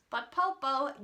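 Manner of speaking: ordinary delivery, nothing marked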